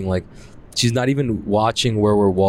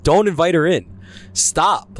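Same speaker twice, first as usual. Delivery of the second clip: retelling a panicked shout to stop her; panicked plea; 9 semitones higher than usual. Retold or imitated. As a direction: beg her to stop, terrified. Panicking as ghost comes in.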